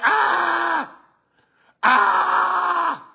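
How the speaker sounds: long high mock scream. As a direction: hold a drawn-out, high "aah" as a pretend scream. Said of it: "you sitting around here like".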